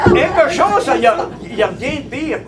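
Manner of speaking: high, loud burst through laughter; laughing. Real, not acted. Storyteller recalling riding the pig, cracking up laughing. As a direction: laugh while getting the words out.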